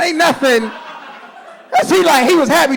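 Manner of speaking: usual speaking voice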